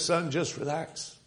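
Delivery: calm reassurance. Acted. Father calming his frightened son during the plague, soft tender reassurance. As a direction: soothe a scared child gently.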